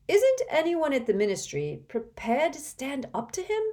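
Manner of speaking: loud, high indignant outburst; angry. Acted. Ron angrily challenges the table about the Ministry. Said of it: "asked Ron angrily".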